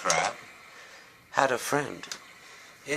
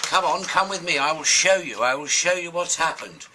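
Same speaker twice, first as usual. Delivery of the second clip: raised, louder seagull calling the crab along; urgent urging; 6 semitones higher, 5 dB louder than usual. Acted. Seagull hurrying the crab to the spaceship, urgent. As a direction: insist they follow, high and loud.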